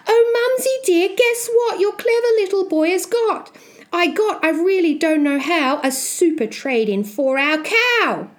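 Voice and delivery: put-on high-pitched excited young Jack; boastful boy. Boy boasting to his mum about a trade, high and excited. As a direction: pipe up proudly, high and eager.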